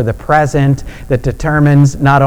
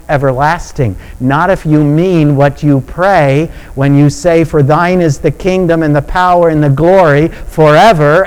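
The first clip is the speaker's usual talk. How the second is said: louder, higher, driving sermon crescendo; impassioned preaching; 5 semitones higher, 5 dB louder than usual. Real, not acted. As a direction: preach it with rising insistence, piling clause on clause. Preacher builds to a climax.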